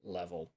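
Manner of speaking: LEVEL held low and even; LEVEL said without pitch movement. Shown as a demonstration. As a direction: keep "level" on one soft, low note. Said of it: "whether the voice is".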